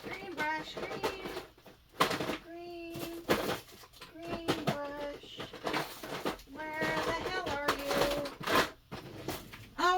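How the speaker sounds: distant, quiet searching call; far off. Real, not acted. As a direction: call absently from away from the mic. Searching off-mic for lost brush.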